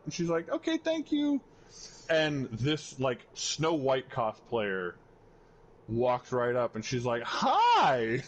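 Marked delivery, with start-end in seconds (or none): 0.5-1.4 much higher, cute streamer voice; a VTuber thanking the booth guy in a high, cute voice. Retold or imitated. VTuber thanking booth guy, high cute voice. 7.2-8.2 high, loud, bright greeting; a VTuber calling out hi to the cosplayer, high and loud; excited hi. Retold or imitated. VTuber greeting cosplayer, high and loud.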